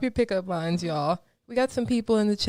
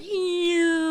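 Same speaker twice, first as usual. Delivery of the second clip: disgusted ew; 9 semitones higher than usual. Retold or imitated. Disgusted reaction to bars.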